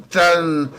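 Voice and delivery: ordinary talk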